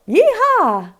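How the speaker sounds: high, loud cowgirl whoop; cowgirl yeehaw. Pretend. Teacher playing a cowgirl for the kids, high loud whoop.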